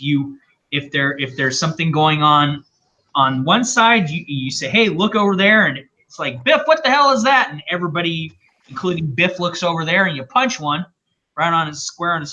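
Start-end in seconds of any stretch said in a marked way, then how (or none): 4.7-5.7 mock calling out. Acting out a distraction trick. 6.4-7.5 high, louder fake astonishment; mock alarm. Acting out a distraction trick.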